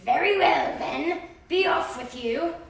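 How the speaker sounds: troll letting the second goat go; troll dismissal. Acted. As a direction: send him off grudgingly.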